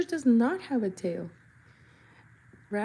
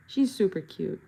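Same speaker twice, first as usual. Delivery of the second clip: normal talking voice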